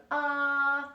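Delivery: long open ah, held for the client; sustained ah. Shown as a demonstration. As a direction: model a clear held ah. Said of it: "I'm gonna hear you go".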